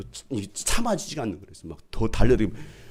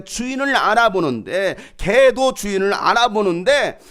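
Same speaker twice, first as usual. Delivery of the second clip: slow, loud, higher preaching; full-force preaching; 10 semitones higher, 7 dB louder, slower than usual. Acted. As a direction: drive the verse home slowly. (느리고 힘찬 설교조)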